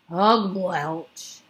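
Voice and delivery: loud gagging disgust; disgusted retch. A narrator's reading. Bloodbottler spits out the vegetable, disgusted retch. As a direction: spit it out in revulsion.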